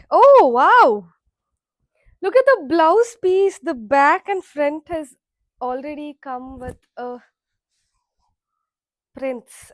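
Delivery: loud thrilled gasp of delight; excited. Real, not acted. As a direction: burst out in delight over the find. Unboxing thrilled by saree.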